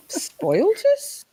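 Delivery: squeaky, high, slow question; high drawn-out question. Real, not acted. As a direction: stretch it up high.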